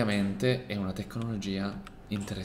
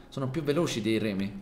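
normal talking voice

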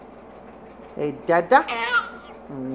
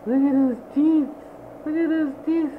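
delighted gasp; 7 semitones higher, 4 dB quieter than usual. Real, not acted. Dad delighted by baby's teeth.

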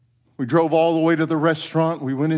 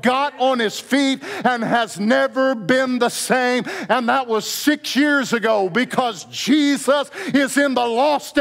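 high, slow, building sermon cry; preacher climbing to a triumphant Amen; climactic preaching; 7 semitones higher, slower than usual. Acted.

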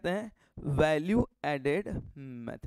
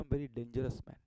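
mock menace; 7 semitones lower, 12 dB quieter, much slower than usual. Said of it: "शक्ल देख के लगता है ना".

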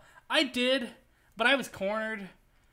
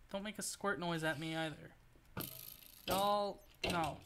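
ordinary talk, nothing marked

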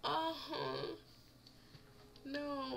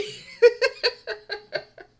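loud high laugh bursting out; big real laugh; burst of laughter; 12 semitones higher, 12 dB louder than usual. Real, not acted. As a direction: crack up at the screen. Laughing after the meat gag.

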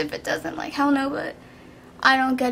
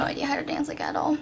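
whispered aside under breath; whispering; 7 dB quieter than usual. Real, not acted. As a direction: drop to a whisper.

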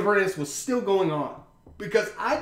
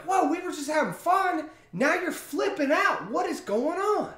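high, bewildered pushback at friends who turned on him; whoa, what just happened here; acting out being caught off guard by angry friends; incredulous protest; 10 semitones higher than usual. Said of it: "'cause you're like".

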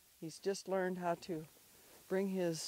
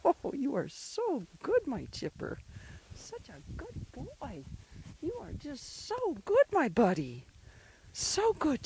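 doting dog praise; 6 semitones higher, 4 dB louder than usual. Real, not acted. Owner praising dog for fetching, doting dog praise. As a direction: coo over the dog, stretching the words.

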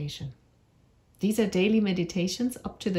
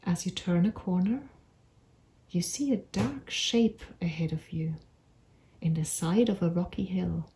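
unhurried meditation voice with long pauses; measured storytelling for a calming visualisation; guided meditation. Acted. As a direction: guide them gently through an imagined walk, phrase by phrase. Leads a guided imagery meditation.

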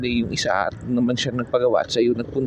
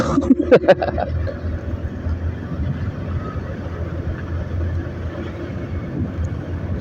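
silent laugh; 5 semitones higher, 6 dB quieter than usual. Real, not acted. Laughs at the shaky camera.

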